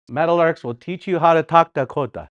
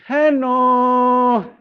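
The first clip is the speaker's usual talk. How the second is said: high, long, sung-out "Hello"; Kushi's greeting; 9 semitones higher than usual. Acted. Imitating Kushi greeting you at home, high drawn-out call. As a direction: sustain a high singsong hello. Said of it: "you go home and Kushi".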